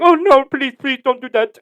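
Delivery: high, loud mock-panicked begging; pleading cab driver. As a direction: beg like a nervous cabbie.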